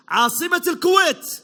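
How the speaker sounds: loud, slow quiz clue to the crowd; called-out clue. Real, not acted. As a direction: call it out slowly to the room. Quiz host calling the clue.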